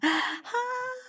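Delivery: high excited greeting; delighted gasp. Real, not acted. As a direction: gasp and call out happily. Gasp then high excited name.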